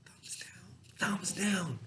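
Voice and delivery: breathy whisper, barely voiced; whispered answer. Shown as a demonstration. Teacher whispering the quiz answer to students. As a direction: whisper the answer like a hint to the class.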